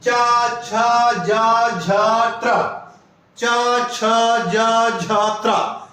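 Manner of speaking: चा छा जा झा त्रा said very slowly, from the palate; slow palatal drill; palatal sounds demo. Shown as a demonstration. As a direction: stretch चा, छा, जा, झा slowly off the roof of the mouth. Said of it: "क्या है तालव्य में".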